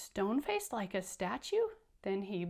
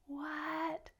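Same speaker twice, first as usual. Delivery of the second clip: mock surprise; 6 semitones higher, 5 dB quieter than usual. Reader's surprised reaction.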